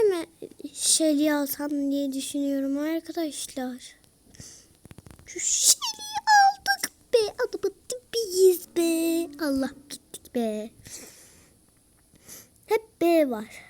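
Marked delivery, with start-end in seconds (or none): 5.3-9.4 squeaky cheer. Real, not acted. Celebrating a kill in game.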